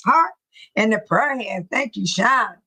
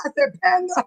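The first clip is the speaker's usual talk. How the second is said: high, giggly words breaking up; helpless giggles over a slip of the tongue; laughing at own slip; 7 semitones higher than usual. Real, not acted. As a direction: talk through real laughter.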